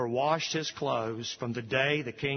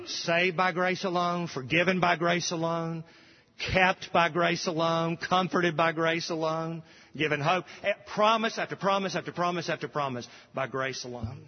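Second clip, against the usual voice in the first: preaching at full force; 4 semitones higher than usual. Acted. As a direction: build it up like a pulpit refrain. Building preached litany.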